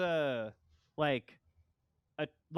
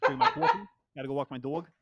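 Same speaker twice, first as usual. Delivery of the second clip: put-on a jokey New York accent, first words pitched way up and loud; 4 dB louder, faster than usual. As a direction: play up a New York guy on request. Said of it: "You wanna bring it out from New York?".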